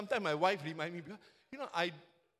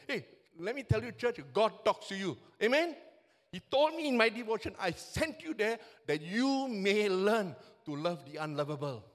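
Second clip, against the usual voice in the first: full-force preaching; 3 semitones higher, 6 dB louder than usual. Real, not acted.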